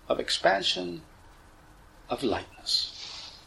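measured cues with long pauses, softening on each relax; guided meditation. Acted. Teacher leading listeners through relaxing the body, guided meditation voice. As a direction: guide them slowly into letting go.

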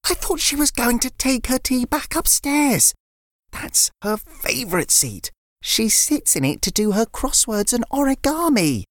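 put-on a girl offering biscuits instead; little girl Layla. Layla voiced, dismayed.

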